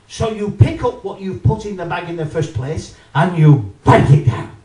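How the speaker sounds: ordinary talk, nothing marked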